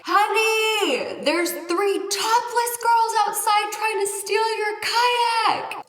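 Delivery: high, loud mock shriek to a husband; hammed-up screaming woman; imitated woman's scream. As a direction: holler it like an alarmed wife, drawn out. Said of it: "I hear this woman scream".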